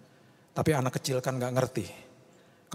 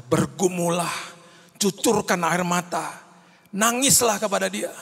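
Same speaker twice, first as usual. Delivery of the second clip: passionate urging; 6 semitones higher, 4 dB louder, slower than usual. Real, not acted. Preacher urging the congregation passionately.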